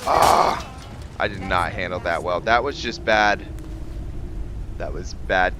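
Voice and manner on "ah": loud, high ah; frustrated yell. Real, not acted. Player botching a fight, loud high yell. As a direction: yell out as the fight goes wrong.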